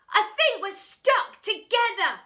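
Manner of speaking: high, loud dismay; dismayed boy. A narrator's reading. Boy realizing they're stuck, alarmed. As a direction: cry out in alarm. Bog voice.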